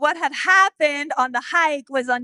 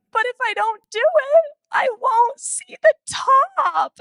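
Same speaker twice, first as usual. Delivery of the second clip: put-on higher, slow, plaintive child voice; a hint of a hesitant little girl; small daughter; 9 semitones higher, 3 dB louder than usual. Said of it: "she goes".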